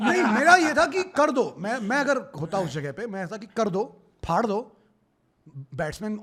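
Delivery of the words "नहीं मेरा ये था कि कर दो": loud, laughing urge to go all out; laughing insistence. Real, not acted. Cricket fan laughing, urging the bowler on. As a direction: laugh while pushing the point.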